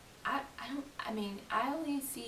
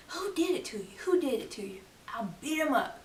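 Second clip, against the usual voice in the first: raised-pitch imitation of a riled-up defender; high, urgent mock demand; mock protective outrage; 7 semitones higher, 4 dB louder than usual. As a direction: leap to someone's defence, playfully overdone. Mimics concerned schoolmates.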